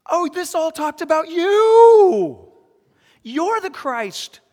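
high, slow, loud cry of revelation; amazed realization. Acted. Disciples suddenly understanding the scriptures, amazed cry. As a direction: draw it out, overjoyed and astonished. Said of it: "and they go".

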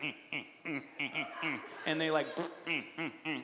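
low closed-mouth snickering hum; lightly voiced gloating pig laugh; mocking pig snicker. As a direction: snicker through the nose like a smug cartoon pig. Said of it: "they're laughing at you".

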